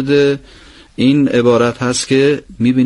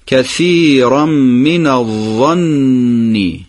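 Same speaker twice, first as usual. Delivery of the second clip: very slow, both شدّه held in من الظن; slow recitation together; much slower than usual. Shown as a demonstration. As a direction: chant it slowly with the class, doubling the consonants. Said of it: "با هم دیگه بخوانیم".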